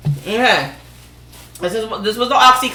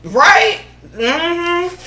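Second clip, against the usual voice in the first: high-pitched disbelief; exasperated disbelief; 7 semitones higher, slower than usual. Pretend. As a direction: squeal out the shock, much higher. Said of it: "you could go".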